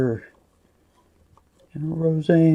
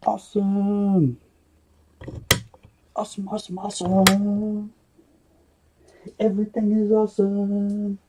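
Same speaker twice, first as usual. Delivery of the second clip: excited cheer; 5 semitones higher, faster than usual. Real, not acted. Cheering the winner.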